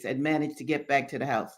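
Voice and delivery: usual speaking voice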